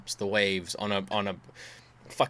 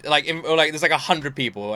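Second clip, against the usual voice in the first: ordinary delivery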